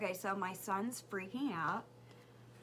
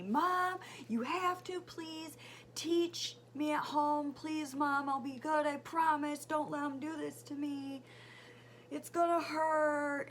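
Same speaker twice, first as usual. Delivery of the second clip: imitated child pleading, higher and louder; mock begging; 6 semitones higher, 5 dB louder than usual. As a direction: plead loudly like a panicking kid. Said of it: "he's begging me".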